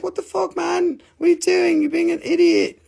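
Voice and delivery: high-pitched mock scolding; imitating a wife. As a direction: scold him in a high wifely voice. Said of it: "His wife must be like".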